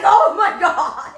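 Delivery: high, loud and slow Oh my God; laughing horrified cry; shocked shriek. Retold or imitated. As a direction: scream it in horror, half laughing. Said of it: "I was like".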